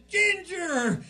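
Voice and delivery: high, loud yell of GINGER; scolding shout. Acted. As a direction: shout "Ginger!" sharply at the dog. Grandma scolds the dog louder.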